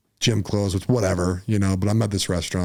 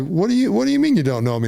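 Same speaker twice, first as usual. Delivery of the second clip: can't believe what you heard; shocked disbelief; 10 semitones higher than usual. Retold or imitated. Dad hurt by his son, higher in disbelief. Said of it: "And I'm like".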